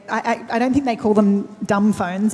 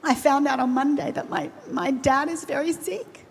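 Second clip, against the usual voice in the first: holding back tears; 4 semitones higher, 4 dB quieter than usual. Real, not acted. Panelist sharing her father's illness with the audience, near tears. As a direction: voice catching, higher and softer as tears rise. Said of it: "I'm gonna try very hard not to cry".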